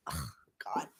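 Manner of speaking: breathy whispered "Oh, God" under her breath; whispered groan. Real, not acted. Streamer fussing with her lights, whispered groan to herself. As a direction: mutter it to yourself, barely voiced.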